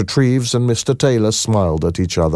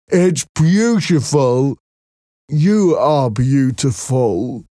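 high, slow, gentle-mannered Merrick voice; timid deformed man, high and slow; Merrick's voice; 5 semitones higher, slower than usual. A narrator's reading. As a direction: voice the shy Merrick higher and slower than the narration.

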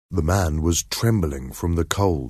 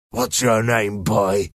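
menacing man. A narrator's reading. Threatening man questions frightened boy.

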